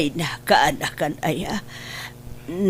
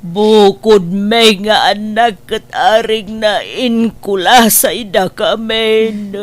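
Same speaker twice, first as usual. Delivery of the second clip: tearful; 4 semitones higher, 6 dB louder than usual. Acted. Aunt tearful over niece's kindness.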